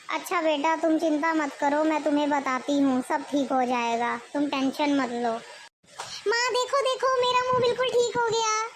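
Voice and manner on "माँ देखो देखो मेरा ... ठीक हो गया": much higher, bright excited cry; delighted girl. Acted. Daughter shows her mother the healed face, thrilled and high. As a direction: show it off, thrilled.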